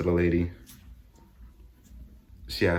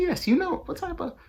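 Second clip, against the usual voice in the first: squeaky defensive. Retold or imitated. Arguing with the vaccine worker.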